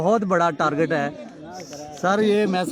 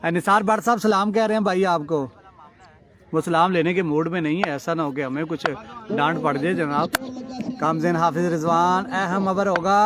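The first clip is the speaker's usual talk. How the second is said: ordinary talk, nothing marked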